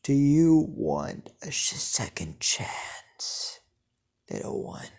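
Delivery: slow whispered devil, trailing into a hiss; evil, drawn-out whispery devil; devilish voice. A narrator's reading. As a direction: tempt them in a slow demonic whisper. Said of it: "evilish and devilish voice".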